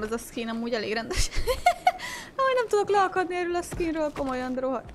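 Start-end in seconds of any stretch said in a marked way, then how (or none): 1.3-2.3 sudden high-pitched laugh; high burst of laughter. Real, not acted. Streamer laughs at the skin. 2.4-4.1 laughing. Real, not acted. Laughing about the skin.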